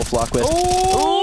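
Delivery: a sudden high, excited cry of the team name; hype call. Real, not acted. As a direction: call it out like the round just swung. Caster calling a big play.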